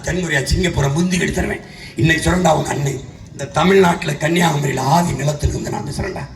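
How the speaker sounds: ordinary talk, nothing marked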